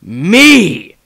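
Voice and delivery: loud, stern bark. Acted. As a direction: cut him off firmly. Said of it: "answered the patriarch sternly".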